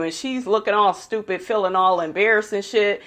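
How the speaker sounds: ordinary talk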